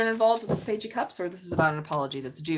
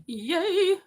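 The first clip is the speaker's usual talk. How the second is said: cheering; 11 semitones higher, 6 dB louder than usual. Real, not acted. Cheering for her own sign.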